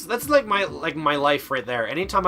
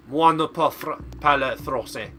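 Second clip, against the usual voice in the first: bad French; 3 dB louder than usual. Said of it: "if you think my English is bad".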